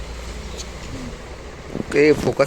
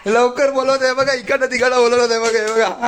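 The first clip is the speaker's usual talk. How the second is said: excited; 10 semitones higher than usual. Real, not acted. Excited as the song starts.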